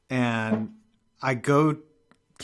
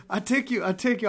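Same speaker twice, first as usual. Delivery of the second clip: higher, insistent mock cabbie; mimicking cab driver; 9 semitones higher, faster than usual. Retelling the cabbie insisting on driving him, high mock voice. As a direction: plead it like a driver desperate to keep the fare. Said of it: "He was like".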